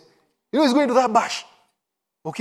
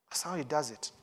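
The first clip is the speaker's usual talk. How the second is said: ordinary talk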